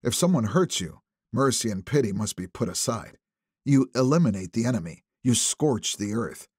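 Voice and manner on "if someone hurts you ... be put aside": usual speaking voice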